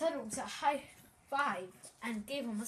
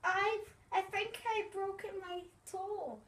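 high, pained little character; hurt puppet voice; 8 semitones higher than usual. Acted. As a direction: squeak it out like a hurt toe. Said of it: "said Tiddles".

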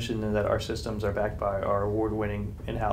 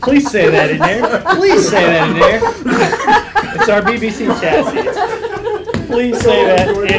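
loud and high through laughter; pleading between laughs; real laughter, higher and louder; laughing; 12 semitones higher, 13 dB louder than usual. Real, not acted. Laughing while begging to keep joke.

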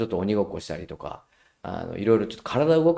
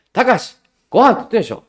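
loud, higher irritated call; 11 semitones higher, 11 dB louder than usual. Retold or imitated. Mother losing patience with Takashi, irritated call. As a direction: let the patience run out.

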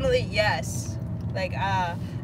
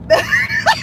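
high shrieking laugh; 10 dB louder than usual. Real, not acted. Joking about mental health credentials.

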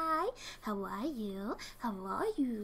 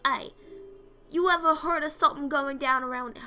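swanky guy voice; 3 semitones higher, 8 dB louder than usual. Pretend. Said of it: "swanky guy voices".

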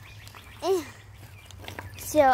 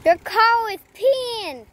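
calling out; 7 semitones higher, 7 dB louder, much slower than usual. Real, not acted. Calling out to mom while riding.

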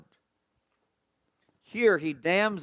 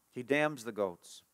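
subdued repetition; 2 semitones lower, 8 dB quieter than usual. Real, not acted. Preacher repeats the verdict on the goats, subdued. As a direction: let it sink in quietly, slowly. Same line repeated, lower and softer.

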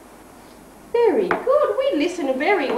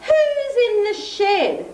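slow, higher, wondering chorus; curious animals; 8 semitones higher than usual. Acted. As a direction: ask it slowly like a crowd of curious animals. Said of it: "everyone said".

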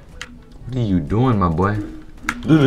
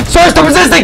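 much higher, louder strained shout; frantic cop yell; 8 dB louder than usual. Pretend. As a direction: scream the order as he pulls away. Cop yelling, escalating.

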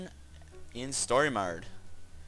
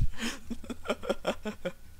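high wheezy giggle; unvoiced giggling; 10 semitones higher than usual. Real, not acted. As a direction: giggle after swearing. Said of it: "Why am I laughing?".

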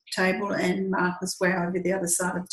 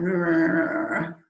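low grumbling growl; grudging growl; 3 semitones lower, 3 dB louder than usual. Retold or imitated. Cheryl grumbling at being told off, low growl. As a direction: growl it like a sulky dog.

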